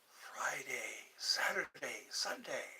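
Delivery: hushed list of days; soft daydreamy whisper. Acted. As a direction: whisper the weekend dreamily.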